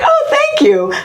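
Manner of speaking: very high, gushing thank-you; grateful wife. Retold or imitated. Wife thanking husband for the pumpkins, gushing imitation. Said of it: "And she's like".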